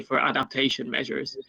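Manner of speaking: normal talking voice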